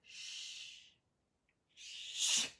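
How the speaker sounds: soft breathy shush to herself; shushing whisper. Real, not acted. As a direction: hush it. Shushing herself after yelling.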